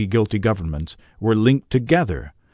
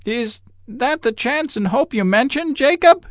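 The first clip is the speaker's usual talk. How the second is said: much higher, shaky rising question; faltering demand. A narrator's reading. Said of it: "he demanded in a faltering voice".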